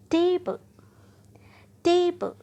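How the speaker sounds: first syllable of table punched high and loud; stress on first syllable. Shown as a demonstration. Teacher showing word stress to learners, table with a stretched, forceful टे. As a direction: drag out the टे in table, let the rest drop. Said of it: "किस syllable को आपने खींच कर बोला".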